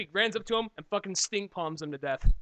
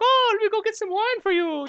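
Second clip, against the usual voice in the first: much higher French accent, mock lady; hammed-up French housewife, high and sweet; French woman impression; 7 dB louder than usual. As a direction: do your best French woman offering wine. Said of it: "that's my best French chick I can do".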